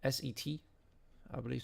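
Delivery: usual speaking voice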